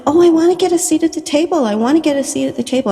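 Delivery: high, fast, whining complaint; whiny voice. Retold or imitated. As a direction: whine it like a kid begging for something. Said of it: "I'm saying it in a whiny voice".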